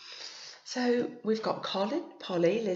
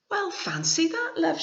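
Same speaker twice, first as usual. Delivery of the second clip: grandma's surprise; 10 semitones higher, 6 dB louder than usual. Acted. Grandma reassures Billy.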